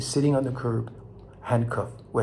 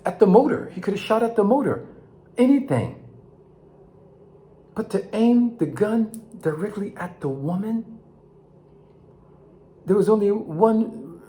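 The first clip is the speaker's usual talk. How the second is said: higher and louder, building to an indignant outcry; rising outrage; 9 semitones higher, 5 dB louder than usual. Real, not acted. Speaker decrying the police shooting, rising outraged. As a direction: pour out the outrage, pitch climbing with each question.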